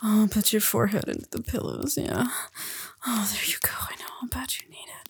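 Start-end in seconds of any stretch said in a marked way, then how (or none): 3.9-5.1 fast, hushed whisper; hushed quick whisper. Acted. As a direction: rush it under your breath. Repeated line, quick hushed take.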